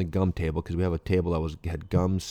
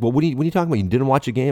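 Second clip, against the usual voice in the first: higher, louder mock interrogation; incredulous questioning; 6 semitones higher, 6 dB louder, much faster than usual. Manager challenging a young player over the game, incredulous retold questioning. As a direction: imitate an exasperated boss demanding an explanation.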